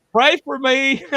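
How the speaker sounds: put-on high, loud kid yell; child's yell. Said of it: "all of a sudden he yelled".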